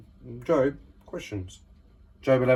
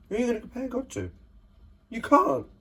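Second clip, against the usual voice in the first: much higher and fast, bursting with wonder; excited disbelief; 11 semitones higher, faster than usual. Real, not acted. Preacher to his listeners, much higher excited outburst. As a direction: fire it off high and quick in amazement. Preacher marvels at God's greatness.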